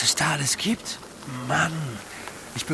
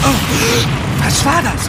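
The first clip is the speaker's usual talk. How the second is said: startled cry; 9 semitones higher, 9 dB louder, much slower than usual. Acted. Startled by a flash.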